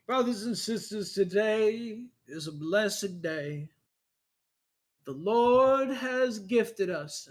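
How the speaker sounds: high, slow, booming preacher. Acted. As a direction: preach it to the congregation, drawn out. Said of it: "the main guy comes back up and he goes".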